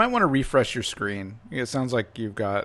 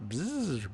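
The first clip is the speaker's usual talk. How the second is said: buzzing robot noise; 6 semitones higher, 5 dB quieter than usual. A narrator's reading. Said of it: "It's just beeping, whirring".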